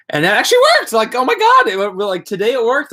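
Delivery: overjoyed that the trick actually worked; thrilled. Real, not acted. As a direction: gush like you can't believe it worked. Thrilled the parenting advice worked.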